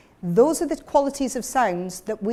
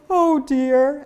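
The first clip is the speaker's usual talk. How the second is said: higher, louder "Oh dear", thin and sobby; thin fold voice; 3 semitones higher, 5 dB louder than usual. Shown as a demonstration. Teacher showing the group the thin-fold sound. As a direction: lift it into a high, sobbing "Oh dear". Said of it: "And I'm gonna go".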